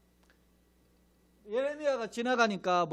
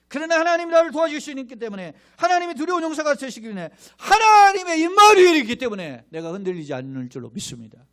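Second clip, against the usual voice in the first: tearful preaching cry; 8 semitones higher, 11 dB louder than usual. Real, not acted. Climactic declaration of faith.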